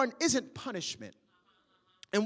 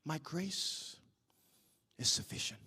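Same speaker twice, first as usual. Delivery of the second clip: breathy low whisper of God's answer; 9 semitones lower, 11 dB quieter than usual. Acted. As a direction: whisper it, lower, as God. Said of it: "I heard the voice of the Lord respond".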